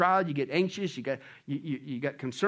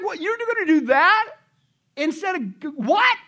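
high, incredulous questioning; mock disbelief; 12 semitones higher, 7 dB louder, slower than usual. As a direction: act appalled, voice pitched way up. Acted disbelief at seeking psychics.

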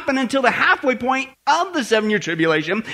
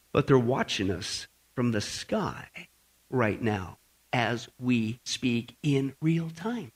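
much lower, softer and slow, like sharing a secret; hushed ominous; 10 semitones lower, 9 dB quieter, slower than usual. Acted. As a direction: drop to a low, slow conspiratorial hush. Preacher dramatizes secret surveillance.

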